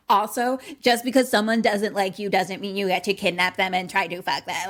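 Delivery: loud, insistent rant; heated telling-off. Real, not acted. Host scolding boy who wants to be loved, heated rant. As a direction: lay down the law loudly.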